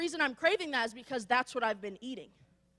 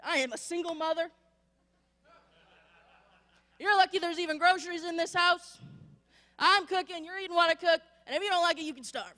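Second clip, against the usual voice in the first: higher, louder mock scolding; raised-voice imitation of a fed-up mom; 5 semitones higher, 4 dB louder than usual. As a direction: play an exasperated mother laying down the law. Acting out mother scolding kids.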